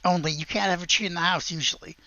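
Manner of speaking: usual speaking voice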